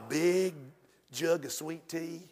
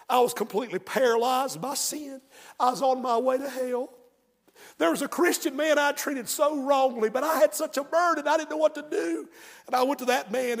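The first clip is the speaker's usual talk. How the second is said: acted emotional confession, pitched higher; tearful testimony; 6 semitones higher, 5 dB louder than usual. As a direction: retell an old man's overwrought testimony, voice lifted. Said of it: "the little man started to cry".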